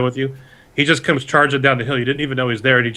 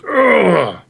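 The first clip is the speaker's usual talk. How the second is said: pained grunt; 8 semitones higher, 4 dB louder than usual. Acted. Bandit chief hit by bolt.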